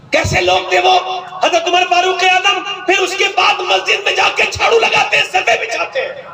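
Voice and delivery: high, ringing sermon cry; preaching at full force. Acted. Preacher to congregation about humble Umar, full-force preaching.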